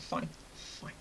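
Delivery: very soft, low-energy agreement; retold sulky quiet concession; grudging surrender. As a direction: give in under your breath.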